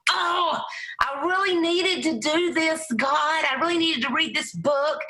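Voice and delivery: a light, high mock-desperate plea; inner plea to God. Speaker recalling her thoughts on the plane, light mock-desperate plea. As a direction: pitch up into a half-comic prayer. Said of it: "And I thought".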